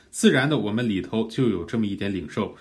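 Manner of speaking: normal talking voice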